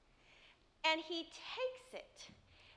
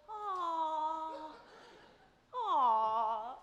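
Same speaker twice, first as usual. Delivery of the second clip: touched aw; 4 dB louder than usual. Retold or imitated. Moved by son's forgiveness.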